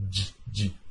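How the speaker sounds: "G, G" as two soft, low j-j bursts; G sound alone. Shown as a demonstration. Teacher isolating the G for students, short soft sounds. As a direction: say "G, G" as bare j sounds, quietly. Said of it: "Olha a diferença do D".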